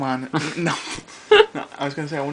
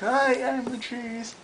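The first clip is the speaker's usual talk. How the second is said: high, slow cartoon cheese; 8 semitones higher, slower than usual. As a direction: draw it out in a high character voice. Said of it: "The guy who voiced the cheese".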